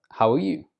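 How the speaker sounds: "How are you?" with pitch lifted and rising on "you"; lifted intonation. Shown as a demonstration. Teacher voices someone greeting a non-native speaker, lifted intonation. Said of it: "use a lifted intonation".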